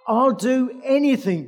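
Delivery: eager plea. Acted. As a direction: promise anything in panic. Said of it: "Jack says to the Lord".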